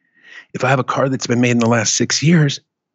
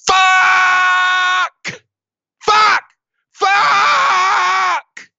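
shocked yelling; much slower than usual. Retold or imitated. Brain hit by an ice cold shower, screaming in shock. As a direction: scream as icy water hits you. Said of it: "It goes".

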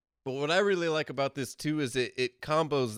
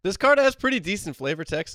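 usual speaking voice

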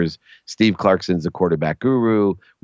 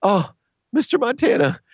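starstruck gushing; 13 semitones higher than usual. Retold or imitated. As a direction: gush like a nervous kid, much higher. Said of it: "I was so nervous".

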